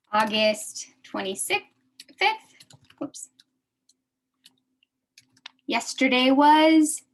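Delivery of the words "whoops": usual speaking voice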